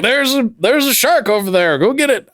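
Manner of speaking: high, urgent mock radio call to the boat. Spotter radioing the boat about a shark, mock urgent. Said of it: "radio down to the boat and be like".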